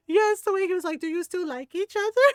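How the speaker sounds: mock kid voice, high and loud; excited retelling. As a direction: bounce up with delight as the nephew. Excited retelling of nephew's question.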